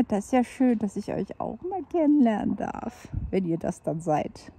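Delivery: ordinary talk, nothing marked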